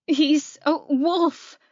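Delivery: high, halting, terrified voice; panicked. Acted. Terrified woman telling a friend what she saw, panicked. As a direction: gasp it out slowly in fear. Terrified, breaking news on phone.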